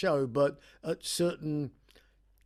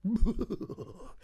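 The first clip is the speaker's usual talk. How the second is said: mock audience laugh bursting out; imitated burst of laughter; 6 semitones higher than usual. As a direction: imitate someone cracking up after holding it in. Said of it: "eventually they'll go".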